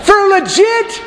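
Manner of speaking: high, slow comic doubt; doubting Abraham. Acted. Abraham questions God about Sarah in a high comic voice. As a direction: squeak out the doubt, wide-eyed.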